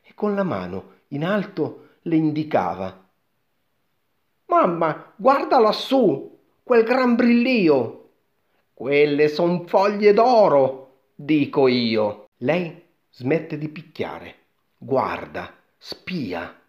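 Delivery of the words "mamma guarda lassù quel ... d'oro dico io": put-on high, loud, drawn-out boy's cry; shouting boy. A narrator's reading. Boy shows his mother the golden leaves, shouting high. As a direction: call up excitedly, stretching the words. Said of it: "e lui gridava".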